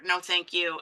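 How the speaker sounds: usual speaking voice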